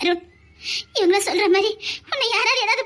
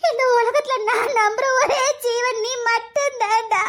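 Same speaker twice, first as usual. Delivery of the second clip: tearful lament. Acted. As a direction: wail and beg him to wake. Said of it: "இப்ப பைத்தியக்காரி மாதிரி புலம்பியதுக்கு". (அழுகையோடு மெதுவாக புலம்பல்)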